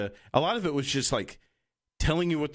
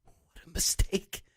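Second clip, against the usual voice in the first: slow, unvoiced whisper; laughing whisper; 7 semitones higher, slower than usual. Imitating a comedian reacting to the network, whispered. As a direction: whisper it through a stifled laugh. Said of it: "He whispers it, laughing".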